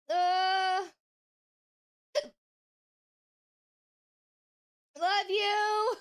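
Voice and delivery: a hiccup jumping into the words. Real, not acted. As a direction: voice catching on hiccups. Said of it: "I keep hiccuping".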